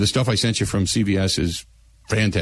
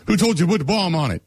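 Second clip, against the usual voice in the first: fast, high-pitched indignant rant; Jackie's Seinfeld voice; 9 semitones higher, faster than usual. Acted. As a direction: deliver it as a hammed-up sitcom quote, high and quick. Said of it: "you gotta say it like that, like Jackie".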